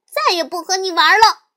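little sister shouting she quits. Acted. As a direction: yell that she's done playing. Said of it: "小熊妹妹也大声喊着".

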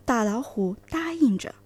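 plain reading voice

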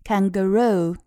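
"kangaroo" with the stress on "roo"; stress on third syllable. Shown as a demonstration. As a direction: lean on the final syllable of "kangaroo". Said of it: "那么重音在后面".

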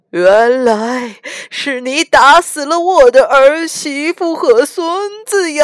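old man's mock wailing grief. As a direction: wail like a grieving old man. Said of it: "嚎啕大哭".

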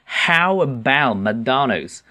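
slow "How about" with stretched ow vowels; "How about" said slowly, ow fully sounded; drilling the ow. Shown as a demonstration. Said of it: "这个ow是比较难发音哈".

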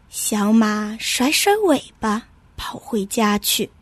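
usual speaking voice

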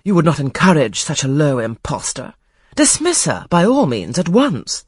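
Lady Ingram scorning the Colonel's idea, loud and contemptuous; crying out haughtily. A narrator's reading. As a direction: dismiss the impostor with scorn. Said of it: "cried Lady Ingram".

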